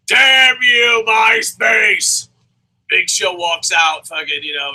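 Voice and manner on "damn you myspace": loud, higher hammed-up outburst; mock Vince curse. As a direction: curse MySpace like a cartoon villain. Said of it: "I just pictured Vince McMahon. He's like".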